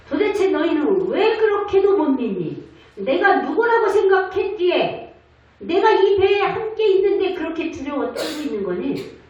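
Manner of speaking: Jesus rebuking. Acted. Jesus rebuking frightened disciples in the boat, scolding. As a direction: scold the disciples for their fear. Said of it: "이거예요".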